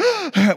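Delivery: sharp, high-pitched gasp; startled gasp. Retold or imitated. Storyteller acts out the family hearing the crash, startled gasp.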